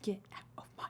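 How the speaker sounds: fast, hushed mock threat; half-joking whispered order. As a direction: whisper it like a secret warning.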